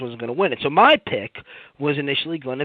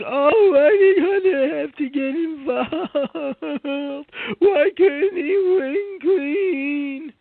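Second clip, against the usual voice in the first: high, slow, whining mockery; mock the crying fans with a whimper; whiny crybaby; 13 semitones higher, much slower than usual. Mocking internet crybabies in a high, slow whine. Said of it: "internet crybabies were crying".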